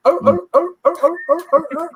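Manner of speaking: high-pitched seal barks; seal bark imitation. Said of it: "I'm not a seal".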